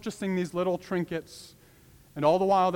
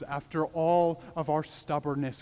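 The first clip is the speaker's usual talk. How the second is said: normal talking voice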